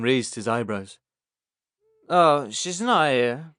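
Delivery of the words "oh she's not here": slow tipsy reply. Acted. Harold's slow, drunken reply.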